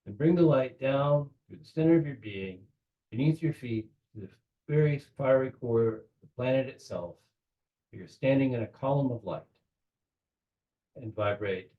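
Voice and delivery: unhurried visualization with long pauses between phrases; measured meditation-leader voice; guided meditation. Real, not acted. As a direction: guide them gently, phrase by phrase.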